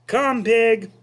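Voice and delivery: high, loud coaxing call; loud sing-song pig call; farmer calling. Acted. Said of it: "said Mister Zuckerman".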